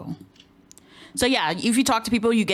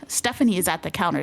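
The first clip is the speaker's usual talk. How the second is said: ordinary talk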